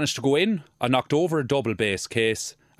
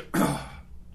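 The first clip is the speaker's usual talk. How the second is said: nervous cough; 6 semitones higher, 4 dB quieter than usual. Real, not acted. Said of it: "A frog in your throat".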